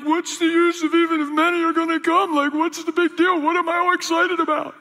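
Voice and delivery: half-acted, strained high cry of despair; retold sobbing plea. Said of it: "I'm sobbing".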